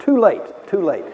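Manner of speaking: loud, high mock-triumphant cry; triumphant gloating. Boy answers grieving family at his funeral, loud gloating.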